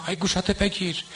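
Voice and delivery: آی گوشاتو بگیر sung out high and falling, mocking; mocking eulogist's wail. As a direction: parody the singer's call, pitch lifted high on گوشاتو. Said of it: "مداحی که بیاد مسخره در بیاره".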